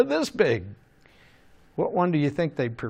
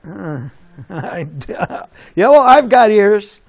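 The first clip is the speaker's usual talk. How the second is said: mock dumb crowd; 5 semitones higher, 9 dB louder than usual. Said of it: "I don't think people went".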